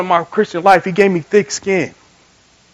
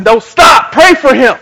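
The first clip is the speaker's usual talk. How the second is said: louder, higher imitated order from God; acted firm command; 6 semitones higher, 9 dB louder, slower than usual. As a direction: insist on it forcefully, as the Lord. Voices the Lord commanding him.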